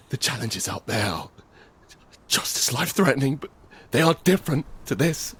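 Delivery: high, urgent begging to a stranger; desperate pleading. Acted. Man pleading with armed woman.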